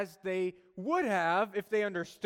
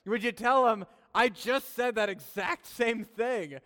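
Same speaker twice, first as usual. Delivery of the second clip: louder mock-exasperated wife, slowing at the end; frustrated wife; 5 semitones higher, 6 dB louder than usual. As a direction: play the fed-up wife asking for credit. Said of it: "she goes".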